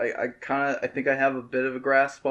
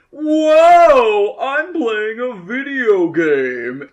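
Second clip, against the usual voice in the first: much higher, louder, slowed mock amazement; mock hyped gamer; 10 semitones higher, 10 dB louder, much slower than usual. Mocking a dumb gamer commentator, hammed-up excitement. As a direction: overdo the wide-eyed gamer wonder. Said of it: "you shouldn't be the guy who goes".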